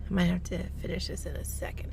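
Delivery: a low, breathy whispered aside. Real, not acted. Whispering to viewers while about to pull away. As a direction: drop to a whisper mid-sentence. Filming while driving, hushed.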